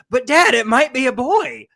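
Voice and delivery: put-on a kid begging his dad to listen; pleading child. Son pleading with his father, high and loud. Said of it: "He's like".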